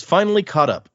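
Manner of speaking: ordinary talk, nothing marked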